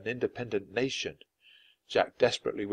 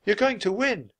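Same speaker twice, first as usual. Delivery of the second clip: put-on high, insistent girl; high-pitched girl's encouragement; Annie's voice; 11 semitones higher, 3 dB louder than usual. A narrator's reading. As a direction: urge it brightly in a raised pitch. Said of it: "said Annie".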